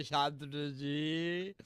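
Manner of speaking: very slow, stretched calling of Shantanu जी; pleading, drawn-out call of Shantanu जी. Acted. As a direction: drag out Shantanu जी with a rising end. Caller repeats the name.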